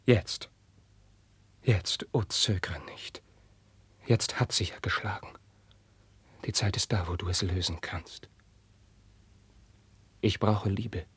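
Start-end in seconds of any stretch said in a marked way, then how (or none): 0.0-8.3 urgent whisper. Acted. Prince begs his friend in a whisper. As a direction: press him in secret.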